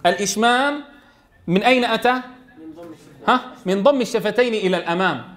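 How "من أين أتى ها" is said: ordinary talk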